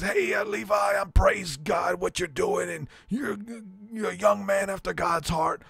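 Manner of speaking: put-on blessing the young man like a fiery preacher; Lou Engle voice. Prank caller playing a preacher to a young fan, shouting high. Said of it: "he does his Lou Engle voice".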